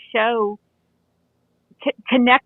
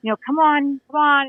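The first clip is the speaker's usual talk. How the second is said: a touch higher, mock flustered apology; apologetic owner; 6 semitones higher than usual. Dog owner apologizing to the speaker for the dog, mildly flustered. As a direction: play the embarrassed dog owner lightly. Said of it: "the people are apologetic".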